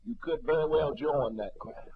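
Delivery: normal talking voice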